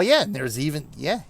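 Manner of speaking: ordinary delivery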